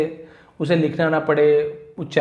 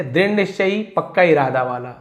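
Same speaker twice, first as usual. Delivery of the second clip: usual speaking voice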